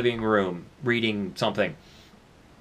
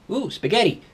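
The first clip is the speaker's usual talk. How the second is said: perked-up roommate; 9 semitones higher than usual. Acted. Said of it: "And your roommate goes".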